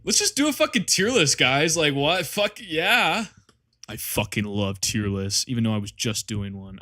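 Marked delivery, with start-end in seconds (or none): 0.0-1.4 excited demand. Real, not acted. Pushing chat for tier list.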